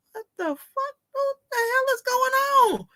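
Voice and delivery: freaking out at the porch camera; shocked. Retold or imitated.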